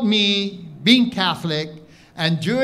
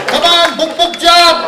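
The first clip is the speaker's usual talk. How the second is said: high loud jeering call; taunting shout; 10 semitones higher, 6 dB louder than usual. Acted. Hawaiians taunting the Filipino jumper, high loud jeer. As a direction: jeer him off the ledge.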